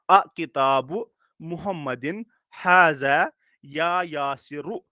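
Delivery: slow अ किताबु मुहम्मदिन हाजा या यासिरु, every short ending sounded; model reading. Shown as a demonstration. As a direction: read it out slowly for students, या यासिरु stretched and ending on -u. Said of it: "फिर से मैं ये पढ़ूंगा". (धीरे-धीरे, यासिरु के अंत में "उ" साफ़ बोलते हुए)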